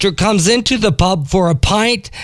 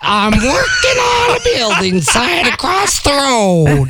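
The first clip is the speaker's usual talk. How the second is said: duck voice, shouted; 6 semitones higher than usual. Acted. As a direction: belt it like a cheeky duck. Duck voice in joke.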